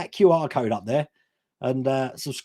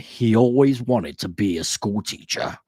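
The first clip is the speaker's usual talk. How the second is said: a hint of a lower, slow trailer narrator; film trailer voice; 7 semitones lower, slower than usual. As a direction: lightly intone it like a movie trailer. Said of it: "that guy that they always use in the film trailers".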